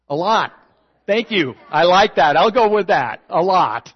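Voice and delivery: loud, beaming approval; excited. Real, not acted. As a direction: cheer the answer back to the room. Answering congregation call-out.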